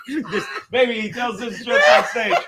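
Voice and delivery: laughing while talking; bursting out laughing. Real, not acted. Laughing at the memory.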